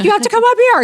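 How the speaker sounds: high, loud re-enacted summons; mock urgent yell. Wife telling her husband to come up, re-enacted urgent yell. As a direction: holler it up the house, playing up the urgency.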